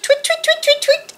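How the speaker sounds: very high, short tweets; chittering starlings. A narrator's reading. Narrator voices starlings near Owl, high chittering. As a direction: chitter rapidly like small birds. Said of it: "The starlings chittered".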